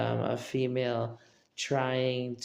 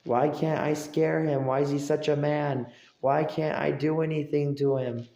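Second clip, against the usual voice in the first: mock frustrated whine; 5 semitones higher, 6 dB louder than usual. Imitating the person who can't scare him, high whiny complaint. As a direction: complain like a thwarted bully. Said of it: "who's like".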